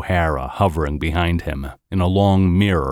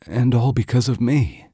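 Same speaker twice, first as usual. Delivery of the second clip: gulping guilt; 6 semitones higher than usual. Acted. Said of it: "she gulped".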